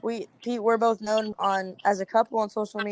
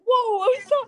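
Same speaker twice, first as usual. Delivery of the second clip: surprised; 11 semitones higher, 6 dB louder than usual. Retold or imitated. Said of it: "And I was like".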